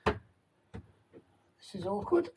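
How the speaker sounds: dragged out, very slow; slow drawn-out. Real, not acted. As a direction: stretch each word out wryly. Cramped under bed, drawn out.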